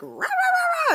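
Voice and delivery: very high, slow, loud mock ranting; mock roar. Husband imagining confronting the coach, mock roar. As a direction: ham up an angry dad's roar. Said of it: "but I might get all".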